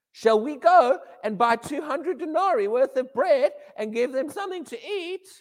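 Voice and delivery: high, whining plea; mock whiny disciples; whingy whiny tone. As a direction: whine it like a complaining child. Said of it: "I said that in a whingy, whiny tone".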